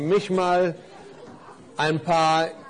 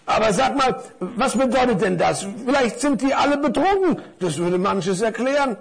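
puzzled scarf; 6 semitones higher than usual. Acted.

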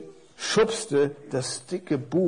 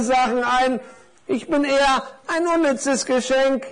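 high, squeaky know-it-all parcel; world-weary little parcel; 10 semitones higher, 4 dB louder than usual. Acted. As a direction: share your experience in a high little voice. Voices the experienced little parcel. (erfahrenes Päckchen mit hoher Stimme)

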